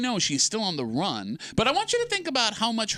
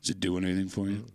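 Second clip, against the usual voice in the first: low mock-seductive voice; sultry voice; 9 semitones lower, 4 dB quieter, faster than usual. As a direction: lay on the fake charm, low. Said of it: "Me talking like this?".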